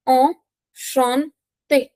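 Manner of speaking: very slow ENCHANTÉ, broken into syllables. Shown as a demonstration. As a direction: stretch out "Enchanté" with gaps for learners. Teacher models word syllable by syllable.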